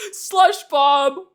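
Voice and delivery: loud, high cartoon villain taunt; booming villain battle cry; bully villain. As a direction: gloat like a Toy Story bully. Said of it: "say it like a fucking villain from".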